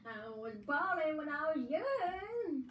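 put-on slow, high, quavering old granny; grandma voice. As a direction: croak it like a weepy grandma. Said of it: "I use my grandma voice".

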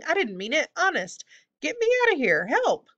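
high, frantic goblin squeal; pleading while writhing. A narrator's reading. Hobgoblin begging to be freed from the beak, frantic. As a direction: climb from plea to loud cry for help. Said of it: "still writhing".

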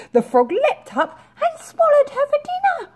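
very high, loud, startling exclamation; shrill loud storyteller twist; dramatic climax. A narrator's reading. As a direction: blurt out the shock.

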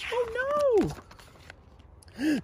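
very high yelp of alarm; his wing is on fire; alarmed cry. A narrator's reading. Zog as his wingtip catches fire, very high.

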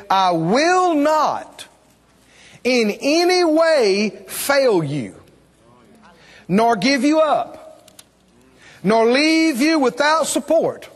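slow, high, ringing preacher's reading; emphatic scripture proclamation. Real, not acted. Preacher reading God's promise to the congregation, emphatic proclamation. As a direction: proclaim each phrase, stretched and separated.

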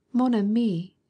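supplicating exclamation. A narrator's reading. Mother pleading with her son to stay, a supplicating cry. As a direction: beg him not to leave. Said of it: "exclaimed the mother, in a supplicating voice".

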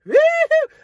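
high, loud celebratory whoop; woo-hoo yelled up high; excited whoop. Real, not acted. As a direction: cheer like the package finally came. Unboxing the long-awaited vape.

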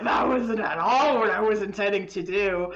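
half-laughing curse at own mistake; laughing dismay. Retold or imitated. Creator's dismayed reaction, laughing.

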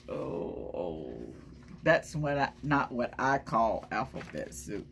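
usual speaking voice

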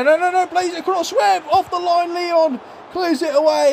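quick, high, frantic commentary; tense excited call. Real, not acted. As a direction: rattle it off as the ball is cleared. Goal-line clearance panic.

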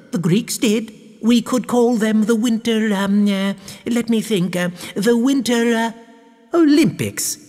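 high-pitched, hesitant improvising; scheming baroness. A narrator's reading. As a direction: think aloud while persuading your husband. Baroness persuades husband.

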